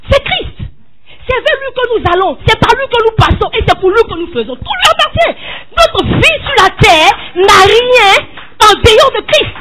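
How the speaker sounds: high, loud, slow preacher's shout; fervent pulpit proclamation; preaching at full force. Acted. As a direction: lift the church with each phrase. Climax of sermon proclamation.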